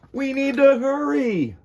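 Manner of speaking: squeaky high space alien; urgent little alien hurrying everyone. Acted. Alien Czar urging the kids to hurry, high and squeaky.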